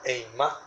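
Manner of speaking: eimá with the stress on the final á. Shown as a demonstration. Preacher teaching a Hebrew word, final-syllable stress on eimá. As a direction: say eimá, accenting its last syllable. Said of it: "termina con acento en la A".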